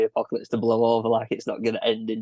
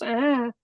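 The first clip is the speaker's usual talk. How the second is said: imitated scream; 13 semitones higher than usual. Podcaster retelling how the film character screams at a kid, high mock scream. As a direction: let out a high-pitched pretend scream. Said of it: "he just screams".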